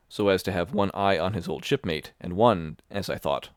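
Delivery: ordinary delivery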